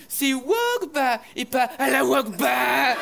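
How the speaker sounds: slow, loud corrective yell; slow, loud, exasperated correction; know-it-all shout. Acted. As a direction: bark the correction syllable by syllable. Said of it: "elle peut pas s'empêcher".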